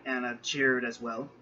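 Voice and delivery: ordinary delivery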